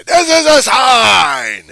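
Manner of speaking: long, high shriek; screaming protester. Acted. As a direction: scream it slowly in someone's face. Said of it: "come up to one of the teachers and say".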